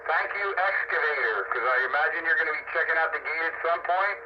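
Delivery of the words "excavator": normal talking voice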